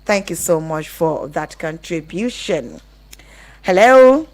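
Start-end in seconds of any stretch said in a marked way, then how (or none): none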